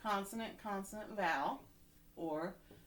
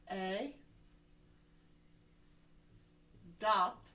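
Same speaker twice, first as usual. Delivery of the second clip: "a", long pause, "dopt"; split by syllable. Shown as a demonstration. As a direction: say "a" and "dopt" as separate pieces. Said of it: "we're gonna split it here".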